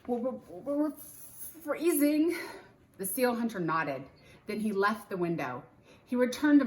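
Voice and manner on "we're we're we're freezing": put-on a child stammering with cold; stammering, freezing. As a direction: stutter with cold, then push out the last word. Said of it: "said Jack".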